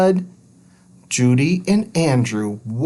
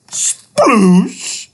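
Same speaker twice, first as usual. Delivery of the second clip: loud, higher sploosh; splash noise; 9 semitones higher, 5 dB louder than usual. Acted. The dog falls into the tub, a loud splash. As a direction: make the splash sound.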